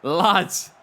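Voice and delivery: mock startled boss; imitating Benji. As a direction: put on the boss's startled voice. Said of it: "He was like".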